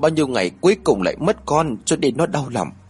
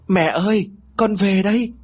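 high, raised call across the house; 8 semitones higher than usual. A narrator's reading. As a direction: call out to mother, pitched high. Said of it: "lớn tiếng gọi".

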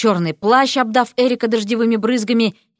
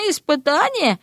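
amused, high-rising query; mocking little question; smirking; 6 semitones higher than usual. A narrator's reading. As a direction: echo it with a scoffing lift. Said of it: "усмехнулся Кощеев".

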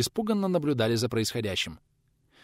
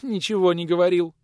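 put-on high, louder frightened young woman; frightened babble; 7 semitones higher, 5 dB louder than usual. A narrator's reading. Youngest woman answers the visitor, frightened and high. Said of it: "испуганно залепетала в ответ самая молодая".

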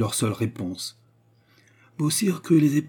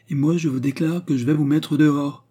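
higher, mildly raised voice; shouted threat; 5 semitones higher, 4 dB louder than usual. A narrator's reading. Host threatening to throw out drunken agents, shouted threat. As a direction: declare it loudly, standing your ground. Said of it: "cria Beausire".

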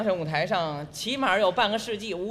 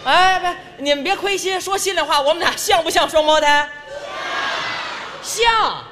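calling out to audience; 8 semitones higher, 5 dB louder than usual. Real, not acted. Performer urging audience response.

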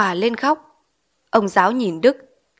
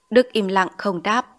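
usual speaking voice

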